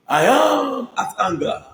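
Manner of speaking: high, slow, drawn-out mock outrage; mock indignant. Vlogger savouring the actress's line, slow and high. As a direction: stretch it out like the actress. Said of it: "drawn-out final imitation".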